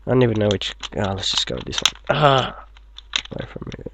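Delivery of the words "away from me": deep voice. Acted. Shooing something away, very low.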